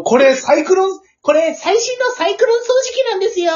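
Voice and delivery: high, eager sales pitch; salesman voice. Retold or imitated. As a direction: pitch a vacuum cleaner brightly. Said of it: "とか言った時に".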